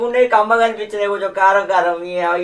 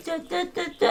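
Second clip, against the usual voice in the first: mocking her muttering; 12 semitones higher, 11 dB quieter, much faster than usual. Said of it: "ఏమో గురుగుతున్నావు".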